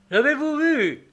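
high-pitched exclamation of frightened surprise; startled terror. A narrator's reading. Postilion turning back to the traveller, startled and terrified. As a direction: cry out high, surprised and afraid. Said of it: "avec une expression de surprise et de terreur".

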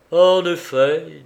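drawn-out, projected show of indifference. A narrator's reading. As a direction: concede it as if it hardly matters. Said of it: "dit-il avec indifférence".

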